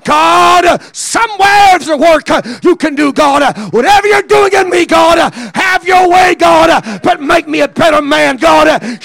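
high, loud, shouting prayer; shouted preaching prayer. Acted. Preacher shouting a prayer to God at full force.

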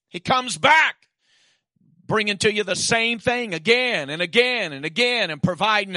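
full-force preaching. Acted.

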